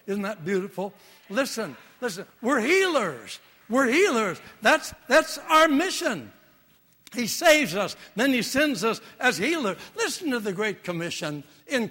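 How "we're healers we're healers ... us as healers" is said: high, loud preacher's cry; preaching at full force. Acted. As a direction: proclaim it to the congregation. Preaching climax.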